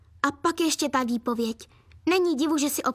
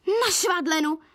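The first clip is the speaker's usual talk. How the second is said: higher, mildly yelped echo in outrage; shrieked question; 4 semitones higher than usual. A narrator's reading. Mum appalled at daughter's sewing plan, yelping. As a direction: echo it as a startled yelp. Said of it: "vyjekla mamka".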